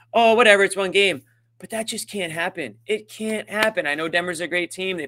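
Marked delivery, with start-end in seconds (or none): 0.1-1.2 loud mocking shrug, higher and louder; dismissive fan. Mocking fans who shrug off the loss, in a loud, high mock-dismissive voice. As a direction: brush it off like a careless fan. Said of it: "you can just, again".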